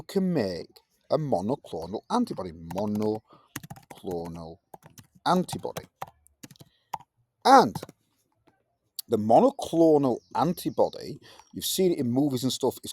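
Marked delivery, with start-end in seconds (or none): none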